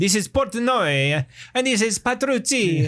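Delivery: high, hammed-up Italian stage actor; Italian accent. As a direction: declaim it in a thick mock-Italian accent. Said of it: "With this Guido Sarducci accent".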